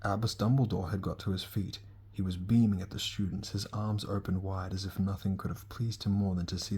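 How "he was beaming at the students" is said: ordinary delivery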